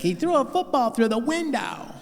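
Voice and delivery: high tattling wife impression; mock wife voice. Wife telling the psychiatrist, high mock voice. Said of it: "She's like".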